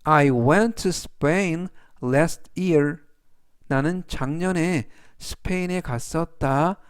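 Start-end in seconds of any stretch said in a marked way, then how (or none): 0.0-3.0 "It has a round shape" read very slowly, word by word; deliberate classroom read; slow, clear reading. Shown as a demonstration. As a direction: spell it out slowly for learners. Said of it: "그래서 전체적 의미는".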